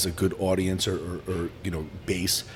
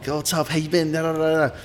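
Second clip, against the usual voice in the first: high chatty greeting; 8 semitones higher than usual. Retold or imitated. Chatty greetings voiced in story.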